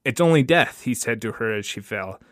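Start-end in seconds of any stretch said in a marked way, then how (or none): 0.0-0.8 high, loud and emphatic; raised-voice, manic dismissal; wild reassurance. Acted. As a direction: throw it out over the gunshot. Gunman to the woman he shot.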